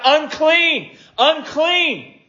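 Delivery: high, loud cry of warning; leper's high warning shout. Acted. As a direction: call it out to keep people away. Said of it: "hold up his hand and shout".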